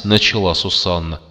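ordinary delivery

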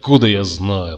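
ordinary delivery

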